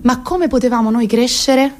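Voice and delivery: ordinary delivery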